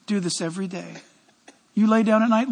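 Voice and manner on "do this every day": "Do this every day" said again much softer; quiet, intimate echo of the command; soft repeat. Shown as a demonstration. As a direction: drop to a near hush on the repeat. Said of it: "Church, listen".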